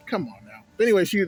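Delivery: usual speaking voice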